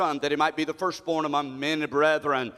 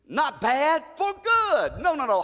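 shouted correction; 8 semitones higher, slower than usual. Acted. Preacher correcting his own misreading, shouting high. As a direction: cry out the correction, climbing high.

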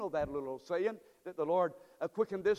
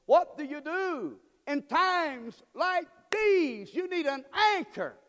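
high, loud, slow preacher's cry; full-force preaching; 8 semitones higher, 6 dB louder, slower than usual. Acted. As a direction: call it out slow and loud to the congregation.

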